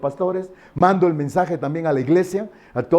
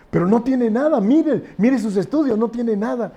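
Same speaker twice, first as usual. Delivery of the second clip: incredulous insistence; 8 semitones higher than usual. Acted. Doctor insisting the tests are clear.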